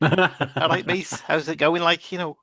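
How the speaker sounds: a friendly Scouse hello; mild Scouse impression; Scouse accent. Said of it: "she sounded so Scouse".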